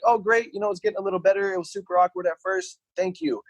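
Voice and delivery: a touch of sarcastic gratitude; mock relieved. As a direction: overdo the relief, rushing the thanks. Retelling his relieved answer.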